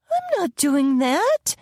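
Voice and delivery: put-on a kid digging her heels in; defiant little girl.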